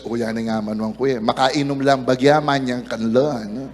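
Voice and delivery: Judas mumbles his excuse in Kapampangan. Acted. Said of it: "Sabi ni Judas".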